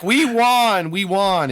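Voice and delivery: high, loud gloating chant; hammed-up victory celebration; mock triumphant cheer. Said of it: "we were like".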